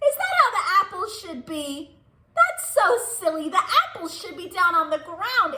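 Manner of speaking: amused giggly teacher voice; laughing. Real, not acted. Teacher laughing with kids at silly bear, giggling.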